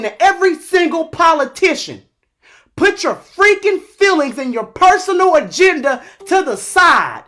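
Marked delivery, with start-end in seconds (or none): none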